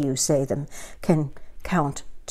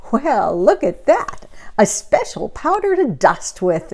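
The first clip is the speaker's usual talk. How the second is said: higher, delighted exclamation; exclaimed; 4 semitones higher than usual. A narrator's reading. Amelia Bedelia finding the dusting powder, delighted exclaim. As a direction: discover something wonderful, higher and brighter. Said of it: "exclaimed Amelia Bedelia".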